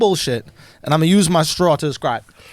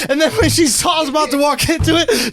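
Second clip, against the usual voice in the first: ordinary talk, nothing marked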